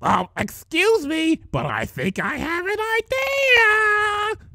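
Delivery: very high, slow, timid mock voice; bad Fluttershy impression. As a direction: squeak it out timidly, badly faking Fluttershy. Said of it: "your Fluttershy voice, it's just terrible".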